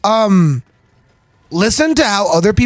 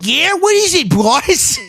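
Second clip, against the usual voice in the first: put-on voice; 10 semitones higher, slower than usual. Answers in a put-on voice.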